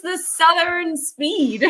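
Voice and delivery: slow, laughing exaggeration; mock Southern slowness. Shown as a demonstration. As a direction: stretch it out in teasing slowness. Mimics slow Southern pace, laughing.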